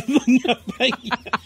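laughing. Real, not acted. Laughing repeat of name.

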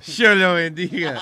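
slow, laughing blessing, "Yo" said as "sho"; a sho-sounding Argentine blessing, laughing; Argentine God voice. Acted.

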